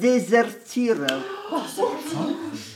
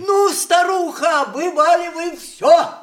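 mocking shout; 6 semitones higher than usual. Man jeering at the grandmother to confess, mocking shout. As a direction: goad the old woman to spill it all.

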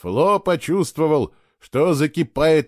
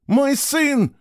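high hysterical cry, much louder; hysterical scream; 14 semitones higher, 4 dB louder than usual. Acted. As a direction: scream it in panic for her child. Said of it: "истерически кричала какая-то женщина".

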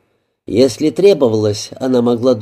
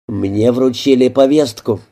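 usual speaking voice

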